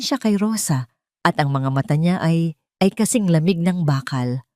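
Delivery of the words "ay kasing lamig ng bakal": plain reading voice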